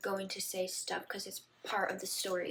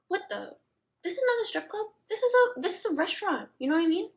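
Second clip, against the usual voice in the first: much higher, louder outrage; indignant; 9 semitones higher, 5 dB louder, faster than usual. Real, not acted. Vlogger scolding her drunk self to viewers, much higher. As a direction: scold your past self.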